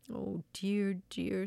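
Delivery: understated storybook regret; sorry. A narrator's reading. Old woman regretting her wish, mildly sorry. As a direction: lament it with a touch of remorse. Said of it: "but sorry".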